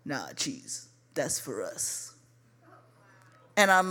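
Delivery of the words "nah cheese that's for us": very low, hushed brush-off; low-key refusal. Retold or imitated. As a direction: imitate him refusing, deep and barely audible. Boyfriend coolly refusing to share.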